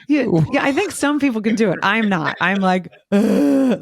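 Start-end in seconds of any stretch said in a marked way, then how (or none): 0.1-1.8 laughing through the words, higher; giggling as she admits it; amused, laughing reply. Real, not acted. Laughing about being a crier. 3.1-3.8 a sudden loud, higher ugly-cry groan; hammed-up loud crying noise; mock loud sob. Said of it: "I'm a loud, not, not like a good crier".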